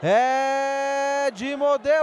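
stretched-out goal shout; long drawn-out goal scream; goal call. Real, not acted.

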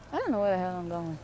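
quiet muttering. Real, not acted. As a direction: mutter it quickly to yourself. Lost, says it again low.

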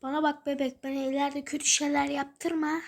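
ordinary talk, nothing marked

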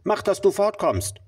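sharp dismissal. Acted. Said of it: "rief der Regenbogenfisch".

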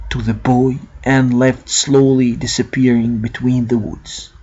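ordinary delivery

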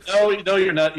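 loud protest. Acted. Stopping the prayer, loud.

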